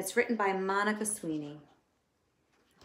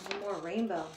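plain reading voice

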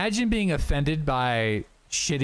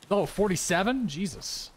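ordinary talk, nothing marked

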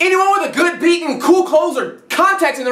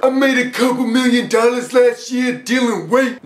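boastful rapper voice. Retold or imitated.

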